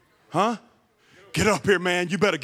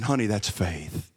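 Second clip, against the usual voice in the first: tender father; 10 semitones lower, 5 dB quieter, slower than usual. Acted. Father's tender closing line.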